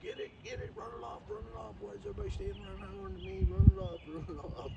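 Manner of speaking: high, playful voice for an excited dog; dog's urgent voice. Retold or imitated. Dog wonders why everybody is hollering, high urgent mock voice. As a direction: egg them on in a high mock-frantic voice. Acts a dog urging at door.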